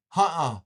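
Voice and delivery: "Huh-uh" as a loud falling grunt for no; Seneca no. Shown as a demonstration. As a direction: bark out a loud "Huh-uh" meaning no. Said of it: "you ever hear someone say".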